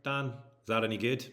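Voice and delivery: light, self-conscious Northern Irish attempt; Northern Irish accent. Shown as a demonstration. Streamer trying a viewer's requested accent, Northern Irish accent. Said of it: "Do your Northern Irish".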